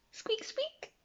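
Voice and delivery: high, soft squeaky squeaks; baby mice squeaking thanks; mouse squeaks. A narrator's reading. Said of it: "they all squeaked".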